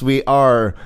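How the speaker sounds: ordinary talk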